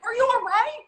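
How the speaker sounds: high, loud mock concern; mimicked concern. Mimicking co-star on the set, high loud concern. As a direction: squeal it like an over-earnest co-star. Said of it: "you being like".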